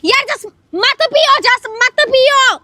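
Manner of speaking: slow, high, drawn-out plea; pleading. Real, not acted. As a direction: beg him to stop. Pleading him not to smoke.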